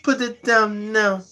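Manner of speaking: high, firm accented command; Schwarzenegger-like doctor accent. Shown as a demonstration. Doctor giving her patient an order, in a Schwarzenegger-like accent. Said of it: "Arnold Schwarzenegger, but her accent".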